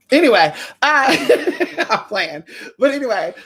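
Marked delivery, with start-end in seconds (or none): 1.2-1.8 short laugh up high; high quick laugh. Real, not acted. Laughing after joking about her looks. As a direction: laugh off the joke.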